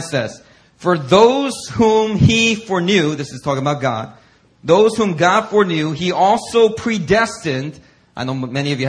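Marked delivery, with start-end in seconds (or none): 0.8-3.2 slow, loud, high scripture reading; preaching scripture. Acted. Preacher reading Romans to the congregation, slow and loud. 4.6-7.8 slow, loud, high scripture reading; preaching scripture. Acted. Preacher reading Romans to the congregation, slow and loud.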